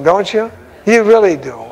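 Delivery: usual speaking voice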